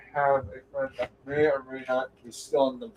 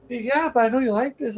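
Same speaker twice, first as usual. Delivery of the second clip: lightly voiced mom, higher pitch; a higher, coaxing mom retold; mom's voice; 10 semitones higher than usual. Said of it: "Mama came home. She's like".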